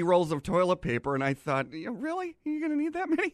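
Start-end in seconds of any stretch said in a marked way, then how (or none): 1.7-3.3 incredulous. Retold or imitated. Incredulous thought about toilet paper.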